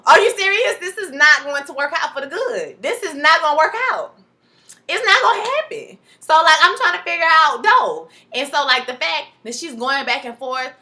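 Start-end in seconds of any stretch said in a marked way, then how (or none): 0.0-6.0 outraged disbelief. Real, not acted. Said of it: "reacting strongly herself".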